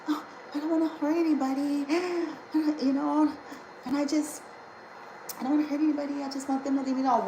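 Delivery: high, fast, timid mocking voice; meek people-pleaser. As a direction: mimic a soft pushover who avoids conflict. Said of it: "It's like".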